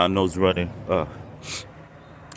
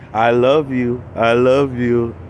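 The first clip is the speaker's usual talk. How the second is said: louder, drawn-out mock "I love you"; gushing impression of a YouTuber; imitating a YouTuber; 4 dB louder, slower than usual. YouTuber gushing at viewers, mock sweet imitation. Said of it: "She keeps saying".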